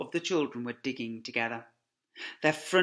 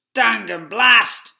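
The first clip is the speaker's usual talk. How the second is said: slow, loud furious curse; cursing Boggis; 9 semitones higher, 17 dB louder, much slower than usual. Acted. Farmer cursing the failed dig, loud and slow. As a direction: spit the curse out. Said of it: "said Boggis".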